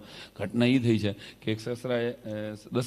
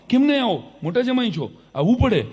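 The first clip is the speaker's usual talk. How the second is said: insistent father-in-law; 9 semitones higher, 3 dB louder than usual. Retold or imitated. Father-in-law insisting, son-in-law refusing.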